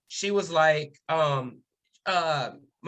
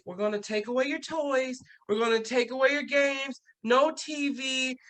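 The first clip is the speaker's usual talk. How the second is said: higher-pitched mock parent voice; stern mom; 7 semitones higher than usual. Other moms telling kids what they lose, mock stern. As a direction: imitate a mom laying down punishments.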